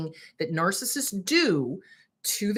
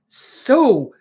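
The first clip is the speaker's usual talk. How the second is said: louder, slower, seething; angry; 3 semitones lower, 6 dB louder, slower than usual. Real, not acted. Telling viewers about RFK, seething anger. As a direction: let the anger boil over on the last words. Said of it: "I'm so angry".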